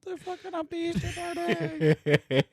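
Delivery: laughing through the words; high, slow, laughing it out. Real, not acted. Laughing while describing.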